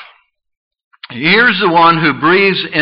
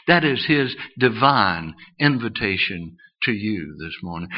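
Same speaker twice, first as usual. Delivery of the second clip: ordinary talk, nothing marked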